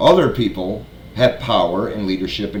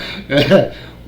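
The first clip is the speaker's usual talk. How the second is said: bursting out laughing; can't hold it in, laughing; real laughter; 3 semitones higher than usual. Real, not acted. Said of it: "I tried to say that with a straight face".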